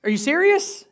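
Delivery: high-pitched incredulous sarcasm; sarcastic, annoyed. Acted. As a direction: ask it high, in exasperated disbelief. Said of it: "a little sarcastic and annoyed at times".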